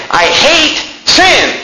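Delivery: drawn-out, high-pitched preached rage; enraged. Retold or imitated. Preacher quoting God on sin, enraged. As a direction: voice God's hatred slowly and emphatically. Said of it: "He's enraged because he says".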